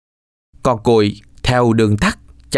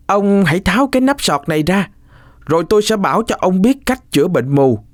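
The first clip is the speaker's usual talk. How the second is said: put-on higher boy's voice; 6 semitones higher than usual. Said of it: "Cuội nói".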